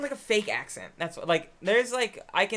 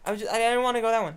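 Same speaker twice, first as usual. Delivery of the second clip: fast, stammering pretend protest; mock excuse; 3 semitones higher, 5 dB louder, faster than usual. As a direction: blurt out a caught cheater's excuse. Continues pretend excuse for cheating.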